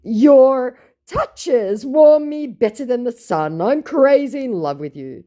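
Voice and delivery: high, loud mock romance, slow at the start; besotted scammer. Scammer flatters her with love lines, hammed-up besotted voice. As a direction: gush like a hammed-up lover. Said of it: "my scammer said".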